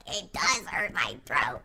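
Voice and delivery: ordinary talk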